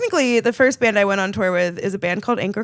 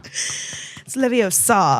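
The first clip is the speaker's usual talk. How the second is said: put-on British accent; much slower than usual. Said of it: "unless it's British accent".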